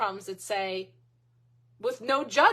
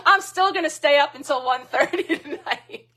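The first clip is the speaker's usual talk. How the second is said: laughing; 5 semitones higher, 8 dB louder than usual. Real, not acted. Admits staying up late.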